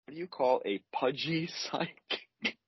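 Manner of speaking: riddle repeated, breaking into a laugh; telling a dad joke. Shown as a demonstration. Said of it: "delivery is gonna be important here".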